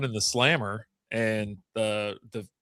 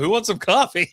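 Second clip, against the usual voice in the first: laughing; 8 semitones higher, 4 dB louder than usual. Retold or imitated. Elijah offering coffee, laughing.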